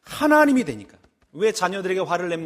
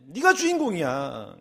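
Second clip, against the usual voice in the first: usual speaking voice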